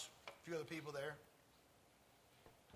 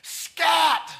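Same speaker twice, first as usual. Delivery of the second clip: high, explosive shout; yell at top of lungs; 9 semitones higher, 11 dB louder than usual. Retold or imitated. Fed-up elderly lady to her dog, full yell. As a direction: yell it like you've finally had enough. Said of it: "she yelled at the top of her lungs".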